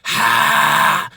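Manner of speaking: loud, harsh screamed "ha"; high scream. Shown as a demonstration. Coach showing the high register, high scream. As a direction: clear the throat high into a scream. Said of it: "High scream would be like".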